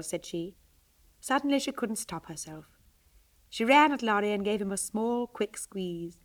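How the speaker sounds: plain reading voice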